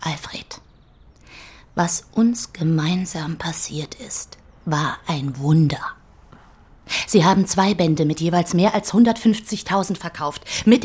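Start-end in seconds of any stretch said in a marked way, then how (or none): 0.1-5.8 slow, unvoiced reverent whisper; coaxing whisper. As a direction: whisper it like a secret miracle. Coaxing the poet softly.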